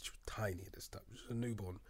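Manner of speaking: soft whispered aside. Real, not acted. Remembering holding his newborn, whispered.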